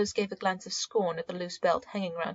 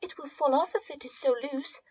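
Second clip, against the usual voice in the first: anxious; 8 semitones higher than usual. A narrator's reading. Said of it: "she said anxiously".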